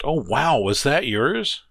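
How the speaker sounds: high, slow wondering wow; awed at the old lap desk; amazed gasp. Acted. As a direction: let the wonder spill out. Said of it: "Lissa gasped".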